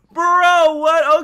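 loud, high-pitched exclamation; shocked disbelief. Real, not acted. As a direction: blurt it out in disbelief. Shocked reaction to what is shown.

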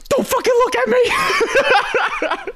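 high, drawn-out squealed yell at onlookers; mock yell. Climber imagining yelling at the watching crowd, mock yell. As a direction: yell it slow and very high, hammed up with laughter. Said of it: "yell in the crowd".

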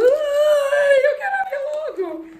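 very high whining cry; impatient squeal. Real, not acted. Creator can't wait to open the plaque, impatient squeal. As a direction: squeal it out, desperate to get it open.

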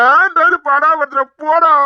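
high, loud and slow, fed up; fed-up shout. Acted. Man fed up with friend nagging him, shouting. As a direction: throw up your hands and yell.

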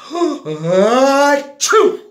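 loud gasping sneeze, high and forceful; huge sneeze. Acted. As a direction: rear back and blast out a giant sneeze. Bear sneezes in story.

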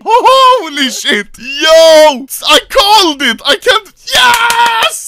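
high, loud, overwhelmed victory cries; real ecstatic outburst; jubilant shouting. Real, not acted. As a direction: shout in disbelief, building to a huge yes. Still celebrating the knife.

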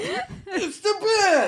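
extremely high squealing goon; absurdly squeaky drawn-out alarm; henchman's cry. Acted. Game henchman warning others, comically squealed cry. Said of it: "henchman in an Arkham game who yells".

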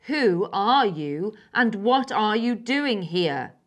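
loud, slow and forceful; firm demand. A narrator's reading. Wild girl confronting a stranger, loud demanding. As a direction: press her hard with each word.

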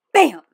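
shouted sound effect; mimicking a slammed desk; loud bam. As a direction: belt out the crash.

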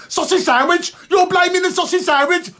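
high, incredulous squawk; mock outrage. Someone defending a sausage sandwich, in high mock outrage. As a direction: question it in high-pitched mock indignation.

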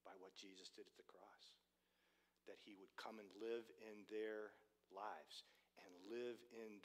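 low, soft, reverent prayer voice; hushed prayer. Retold or imitated. Preacher recounting the couple's roadside prayer, hushed and reverent. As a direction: let it settle low and quiet, as if praying.

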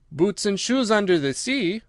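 usual speaking voice

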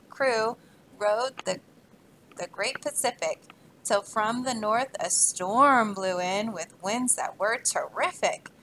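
put-on rhythmic read-aloud to children; story reading. Teacher reading a rhyming book to kids, lively. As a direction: read the rhyme to a class, bouncing along.

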